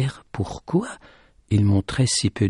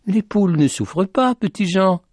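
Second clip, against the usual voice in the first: high-pitched called reply; calling back; 9 semitones higher than usual. Acted. The lady answers Petit Jean about the hens, calling back.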